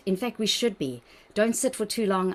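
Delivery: usual speaking voice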